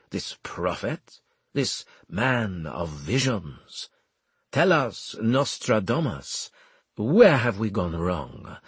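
slow, savoured mock reverence; displeased commander feigning awe of a prophet; Armel, sarcastic. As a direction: draw it out with theatrical irony.

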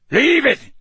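high, loud shouted command. A narrator's reading. Moody ordering Crabbe off the ferret, shouting. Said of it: "Moody shouted".

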